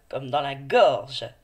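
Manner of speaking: "gorge" drawn out slowly with a hard /g/; slow, stressed example. Shown as a demonstration. Teacher showing the G sound in "gorge", slowly. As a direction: stretch "gorge" to show its /g/. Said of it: "Il s'agit du son G".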